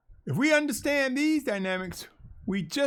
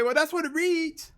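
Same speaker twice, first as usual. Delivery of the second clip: higher-pitched mock objection; objecting reader; 7 semitones higher than usual. Preacher imitating those who insist on the text, higher objection. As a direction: voice the doubters' retort in a higher pitch. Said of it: "And they'll say".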